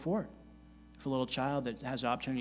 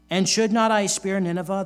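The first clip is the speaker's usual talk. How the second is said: forceful reading; 6 semitones higher, 11 dB louder than usual. Acted. Reading God's words with force.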